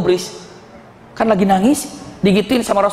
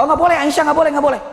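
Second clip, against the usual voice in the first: higher-pitched, repeated mock prohibition; firmly forbid, pitched up; insistent refusal; 6 semitones higher, faster than usual. Prophet hypothetically forbidding Aisyah to visit graves, insistent refusal.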